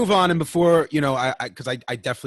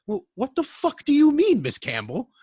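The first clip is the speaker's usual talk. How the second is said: mock indignant; 6 dB louder, slower than usual. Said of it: "And I was like".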